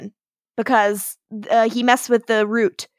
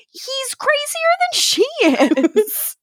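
mock crazy; 14 semitones higher than usual. Quoting Cole about his father.

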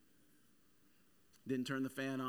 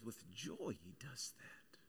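hushed, breathy whisper of the verse; 4 semitones lower, 12 dB quieter, slower than usual. Acted. Pastor whispering the passage to the congregation, hushed. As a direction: lean in and whisper it like a secret.

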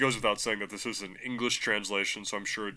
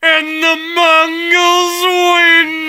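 drawn-out, high, loud victory cry; mock triumphant shout; 13 dB louder, much slower than usual. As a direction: bellow it like a conquering herald. Triumphant joke ending the episode.

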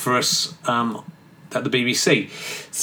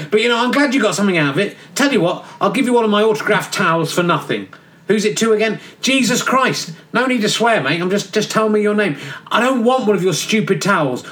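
cheeky geezer John; 6 semitones higher than usual. Acted. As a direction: play John as a careless lad in a cell. Bickering, John then Jesus.

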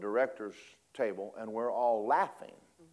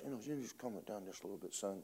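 hushed; 3 semitones lower, 15 dB quieter, faster than usual. Retold or imitated. Father hushing son at funeral.

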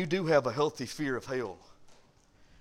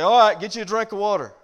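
louder, higher mother calling back up; mother answering; 6 semitones higher, 10 dB louder than usual. Retold or imitated. Mama calls up to her son, louder and higher. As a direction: answer the child from downstairs, a bit patient. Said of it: "And the mama would say".

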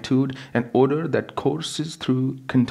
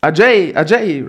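higher, louder desperate call; scream; 8 semitones higher, 10 dB louder than usual. Acted. Wife screaming to her husband for help, desperate. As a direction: scream his name for help. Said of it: "Rama screamed".

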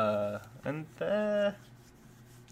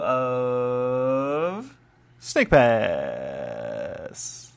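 drawn-out mock announcement; 7 dB louder, much slower than usual. Podcaster launching the Snake Pass review, mock announcer.

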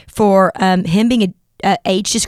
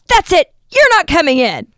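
bouncer snapping; 7 semitones higher than usual. Retold or imitated. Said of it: "he goes".

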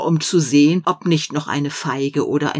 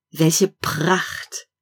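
usual speaking voice

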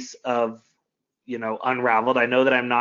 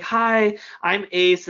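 higher, bright forced hello to a stranger; awkward stranger intro; 8 semitones higher, much slower than usual. Retold or imitated. Nervous instructor greeting retreat guests, high forced cheer. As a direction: chirp it like you're nervously introducing yourself. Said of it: "I have to go up and be like".

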